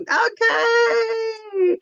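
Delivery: very high, long drawn-out whoop; celebratory cheer. Real, not acted. Cheering after getting the name right.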